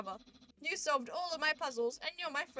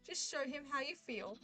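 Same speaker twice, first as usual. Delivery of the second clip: usual speaking voice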